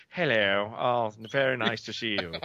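put-on posh British greeting; posh English accent. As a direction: mimic an upper-class English accent. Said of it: "That's very posh".

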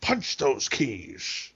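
Scottish-tinged movie star voice, very slow at the end; Sean Connery impression. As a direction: drawl it like a lightly done Connery. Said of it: "was the Connery".

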